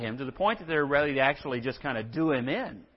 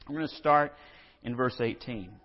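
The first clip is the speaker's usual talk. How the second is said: normal talking voice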